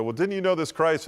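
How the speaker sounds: high, loud pointed question; accusing bystander. Retold or imitated.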